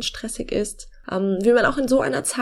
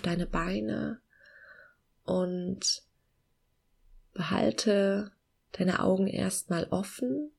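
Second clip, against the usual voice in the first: slow, soft meditation voice with long pauses; calm guiding voice, gently slowing down; guided meditation; 5 dB quieter than usual. Acted.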